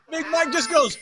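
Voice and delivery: ordinary talk, nothing marked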